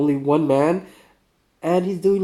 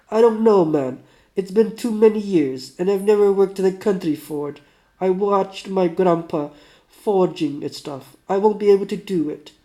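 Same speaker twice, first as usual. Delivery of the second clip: put-on doubtful Russian accent, higher and louder; 4 semitones higher, 5 dB louder than usual. Sick man doubting he can work a forge, loud Russian accent. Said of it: "do my best, uh, Russian".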